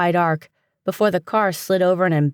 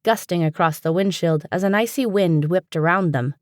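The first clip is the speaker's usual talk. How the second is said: ordinary delivery, nothing marked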